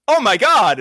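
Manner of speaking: mock exclamation. Host mimics overwrought comic-book dialogue, mock exclamation.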